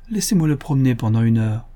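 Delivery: plain reading voice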